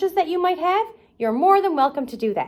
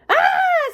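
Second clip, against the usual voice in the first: high startled shriek of fright; cartoon scream. Patrick woken by the bunny, high screamed fright. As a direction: yelp like you're woken by a monster. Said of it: "screamed Patrick".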